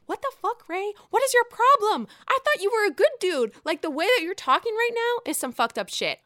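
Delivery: high, loud furious yelling; angry yell at Ray. Retold or imitated. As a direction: scream at him through the TV. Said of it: "shake Ray".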